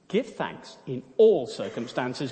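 all stressed, slow and firm; firm correction. Acted. Betsy correcting her sister, firm. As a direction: lean on all, slowly. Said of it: "Betsy corrected".

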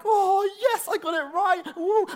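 high, loud gleeful whoop; excited cheer. Retold or imitated. Learner cheering a right answer on Duolingo, high and loud. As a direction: celebrate like you just nailed it. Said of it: "And you're like".

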